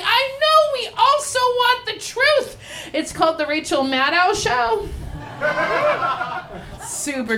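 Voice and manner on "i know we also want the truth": viewers. Retold or imitated. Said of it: "they're like".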